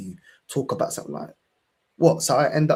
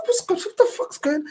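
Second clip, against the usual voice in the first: much higher, stunned; can't believe what he's hearing; incredulous outburst. Retold or imitated. Retelling confronting his family, high disbelief. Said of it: "I said".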